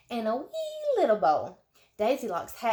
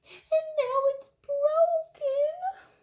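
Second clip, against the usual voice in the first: tiny squeaky cub voice, much higher; wee little voice; much slower than usual. A narrator's reading. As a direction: squeak it like a tiny baby bear. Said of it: "in his wee little voice".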